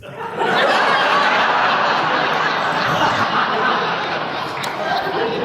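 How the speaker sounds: breathy laugh rising high and falling away; long high laugh. Real, not acted. Retelling the mates' prank call, long high real laugh.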